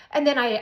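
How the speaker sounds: sharp high gasp for air; sudden gasp. Retold or imitated. Jolting awake from sleep, a sharp high gasp. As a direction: snap awake sucking in a breath. Waking up unable to breathe.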